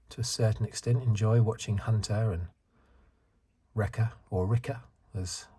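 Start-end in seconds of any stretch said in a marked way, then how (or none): 4.5-4.8 put-on New Zealand accent. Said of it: "with her New Zealand accent".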